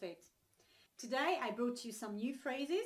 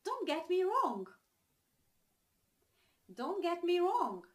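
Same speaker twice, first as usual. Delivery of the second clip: clear, raised, punchy teaching delivery; model phrase; 6 semitones higher, 5 dB louder than usual. Shown as a demonstration. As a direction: present the phrase high and loud, falling on wrong. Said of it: "The first one is the following".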